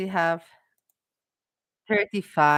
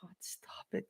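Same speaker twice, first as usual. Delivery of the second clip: breathy whisper, no voice; 19 dB quieter than usual. Real, not acted. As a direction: hiss it under your breath. Pleads with the chat.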